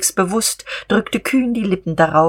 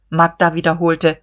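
usual speaking voice

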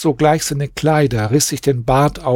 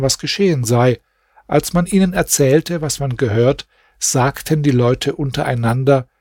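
plain reading voice

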